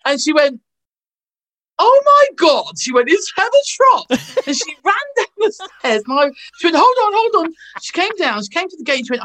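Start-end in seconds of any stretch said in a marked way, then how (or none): 1.8-2.7 high, loud starstruck gasp; mock starstruck fan squealing; excited shriek. Starstruck woman at the gate. 3.1-4.0 high, loud excited recognition; mock thrilled fan shrieking. Woman recognises the actress. 6.8-7.5 high, loud eager call; mock flustered fan calling out; excited call. Woman rushing downstairs.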